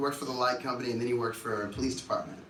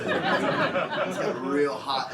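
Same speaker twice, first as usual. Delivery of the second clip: ordinary delivery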